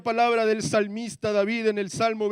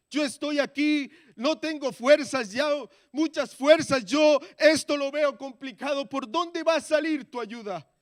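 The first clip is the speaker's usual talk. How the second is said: pleading prayer, louder and higher; súplica desesperada; 6 semitones higher, 5 dB louder than usual. Retold or imitated. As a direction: cry out to God, worn out. Oración desesperada recordada. (súplica desesperada, más alta y fuerte)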